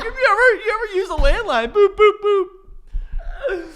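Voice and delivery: put-on high, loud laughing kid voice; silly high voice. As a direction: squeal it like an excited kid. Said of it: "and we were like".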